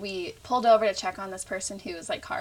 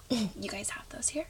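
hushed, unvoiced aside to a friend; whisper; 8 dB quieter than usual. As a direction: lean in and whisper it so nobody hears.

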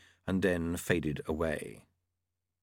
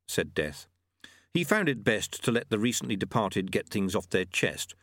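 ordinary delivery, nothing marked

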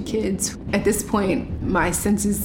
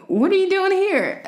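a high, incredulous demand from the police; a quick, high challenge; suspicious challenge; 12 semitones higher, 3 dB louder than usual. Retold or imitated. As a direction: voice the cop's surprised suspicion. Plainclothes police challenge her.

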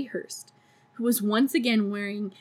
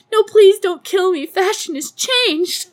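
desperate plea; 10 semitones higher, 9 dB louder than usual. Retold or imitated. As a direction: plead like a doomed victim. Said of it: "the desperation in her voice".

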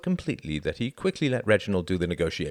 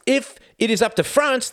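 louder, much higher challenge; a diplomat pressing his point louder; pressing harder; 7 dB louder than usual. Acted. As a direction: press the point home hard.

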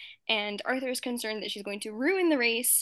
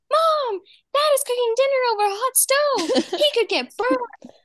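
put-on high, loud little girl calling out; shrill mock child yell of Mom; imitating a bossy little sister; tattling kid; 14 semitones higher, 8 dB louder than usual. D W tattling on dad.

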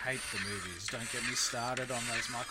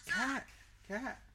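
put-on little kid voice; 13 semitones higher than usual. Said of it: "he kept talking to his parents".

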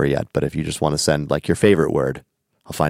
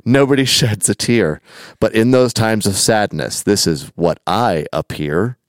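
slow, measured recital of song lyrics; deliberate, rhythmic read-aloud; verse recitation; 3 semitones higher, slower than usual. Acted. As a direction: recite the verse without singing it.

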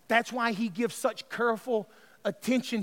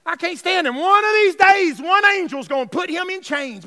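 loud, high preaching shout; full-force preaching; 9 semitones higher, 13 dB louder than usual. Real, not acted. Preacher declaring his dislike of the devil, full-force shouted preaching. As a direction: proclaim the devil's downfall at full voice.

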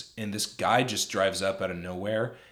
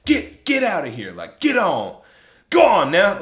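higher, louder, chasing him off; mock shout; 9 semitones higher, 11 dB louder than usual. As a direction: bark him away like a tough guy, hammed-up. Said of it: "He goes".

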